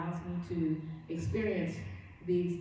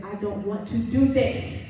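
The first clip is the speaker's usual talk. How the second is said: anguished cry; 8 dB louder, slower than usual. Retold or imitated. Grieving mother in labor retold.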